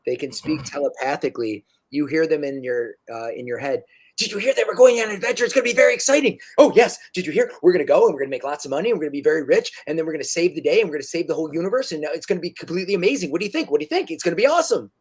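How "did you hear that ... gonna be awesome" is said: excited telepathic gnome. Acted. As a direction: babble on like an overexcited little creature.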